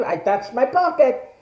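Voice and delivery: ordinary delivery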